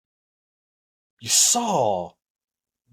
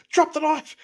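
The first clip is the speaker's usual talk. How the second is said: cop command; 3 dB louder than usual. Retold or imitated. Cop commanding in retold story.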